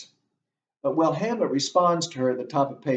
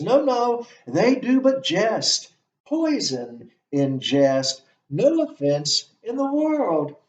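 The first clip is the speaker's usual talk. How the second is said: much higher and louder, mock innocent; mocking Hamlet; 9 semitones higher, 6 dB louder, slower than usual. Lecturer playing Hamlet mocking Claudius, high mock reassurance. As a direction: wave it off with false cheer.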